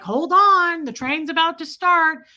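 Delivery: high, loud mock announcer; train announcement. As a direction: call out a train's departure warning. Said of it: "those things that say".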